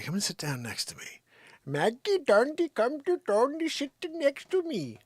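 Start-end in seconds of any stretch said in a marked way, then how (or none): 1.6-5.0 much higher, sing-song pet calling; mock Saint Bernard babble. Shown as a demonstration. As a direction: coax in made-up dog language. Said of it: "how I say".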